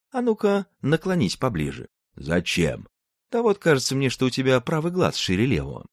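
put-on a sly girl luring him closer; high young woman voice. Tatyana lures the giant.

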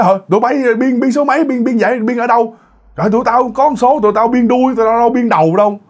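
high-pitched mock bickering; squabbling crowd. Retold quarrel over betting number.

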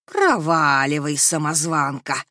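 slow, menacing ghost voice; otherworldly voice. Acted. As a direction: loom over her like a spirit. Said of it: "подала потусторонний голос Архилия".

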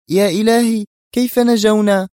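high, loud amazement; astonished. A narrator's reading. Woman asks how they survived, astonished. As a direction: exclaim in wonder, pitched right up. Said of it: "في دهشة".

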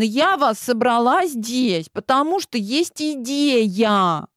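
put-on high, slow, spoiled girl voice; bossy little princess. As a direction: lay it out like a pampered princess. Clementine's voice continues.

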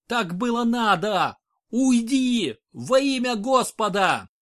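high, loud desperate cries; frantic yelling. A narrator's reading. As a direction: scream the confession in anguish. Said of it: "заорал бывший конюх".

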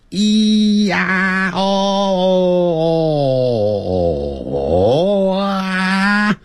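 high, long held "咿呀哦哦", gliding through open vowels; sustained vowel demo. Shown as a demonstration. Teacher showing students what vowels are, long sustained open sounds. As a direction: hold "哦哦啊" on one high continuous voiced tone. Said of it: "嘴巴张开震动声带".

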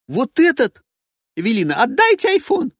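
high, loud demanding cry; a woman demanding her phone; shrill diva voice. Acted. Evelina voiced.

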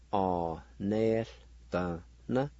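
"or, nel, the, na" with short gaps between syllables; syllable-by-syllable pronunciation. Shown as a demonstration. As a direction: teach "or, nel, the, na" piece by piece. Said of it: "it's pronounced like this".

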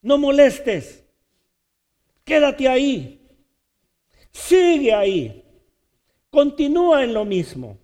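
loud, mocking commands to be silent; scolding crowd. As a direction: hush him down with contempt. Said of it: "le decían". (multitud que lo manda callar)

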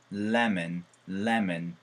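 lemon ending in a short "en"; lemen sound. Shown as a demonstration. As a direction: say "lemon" as "lemen", weak second vowel. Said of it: "레몬이라고 할까요?".

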